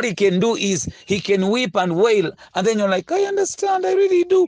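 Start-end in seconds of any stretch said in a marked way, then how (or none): 3.1-4.4 much higher, sweet mock girlfriend voice. Preacher mimics the girlfriend answering her boyfriend, high and mock-sweet. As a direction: squeak it like a lovesick girlfriend.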